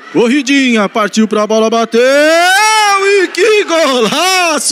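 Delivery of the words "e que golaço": long drawn-out goal cry; explosive goal commentary. Acted. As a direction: roar the goal and the scorer. Goal scored by Mota.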